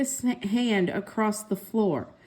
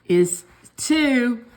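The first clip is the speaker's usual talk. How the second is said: louder stubborn brother retort; bickering brother; 4 dB louder than usual. A narrator's reading. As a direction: dig in like a stubborn boy. Siblings bickering over pencil.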